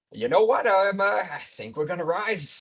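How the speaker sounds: high goofy Boston ape voice; Boston accent. Retold or imitated. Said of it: "an ape with a heavy Boston accent".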